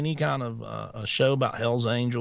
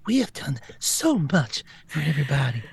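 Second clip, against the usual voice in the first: breathy, whispery politician put-on; mock a self-satisfied politician, slipping into whisper; Gavin Newsom. Co-host mimicking a politician who talks like this, whispery mockery. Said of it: "He talks like this".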